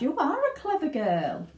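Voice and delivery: high, gushing mother; Mrs Beauregard. Acted. Mother praises Violet proudly, gushing. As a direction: fawn over her daughter. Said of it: "said Missus Beauregard".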